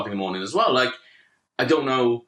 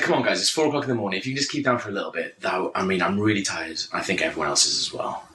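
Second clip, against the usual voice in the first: low, hurried polite plea; 5 semitones lower than usual. Retold or imitated. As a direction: drop your pitch and keep it reasonable. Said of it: "I was using this really some low tone".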